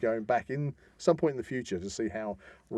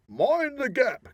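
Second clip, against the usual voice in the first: announcer call; 11 semitones higher, 3 dB louder, much slower than usual. Pretend. Podcast sign-off catchphrase.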